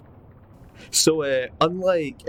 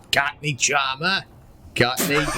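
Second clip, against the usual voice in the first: badly faked Cockney; Cockney accent; 2 semitones higher than usual. Player hunting for a Cockney accent, put-on Cockney. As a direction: have a shaky go at Cockney. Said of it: "do your best Cockney accent".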